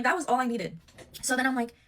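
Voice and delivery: ordinary talk, nothing marked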